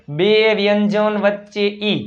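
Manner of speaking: બે વ્યંજન વચ્ચે ઈ slowly, each word stretched; slow, spelled-out repeat of બે વ્યંજન વચ્ચે ઈ; slow rule reminder. Shown as a demonstration. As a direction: draw out બે and વચ્ચે so learners remember. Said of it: "ઈનો ઉચ્ચાર યાદ કરો".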